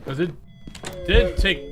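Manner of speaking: normal talking voice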